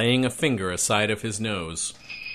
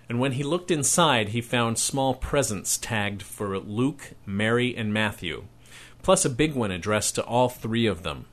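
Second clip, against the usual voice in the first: ordinary delivery, nothing marked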